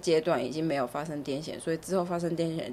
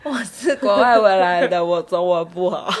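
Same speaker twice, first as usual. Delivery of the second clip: foreigner speaking Mandarin; 7 dB louder than usual. Pretend.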